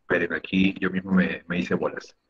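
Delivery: usual speaking voice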